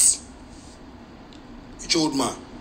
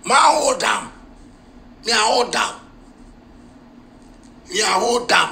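shouted slogan; 6 semitones higher than usual. Real, not acted.

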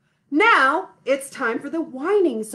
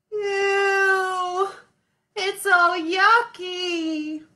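mock crying; 8 semitones higher, much slower than usual. Song, baby gets yucky.

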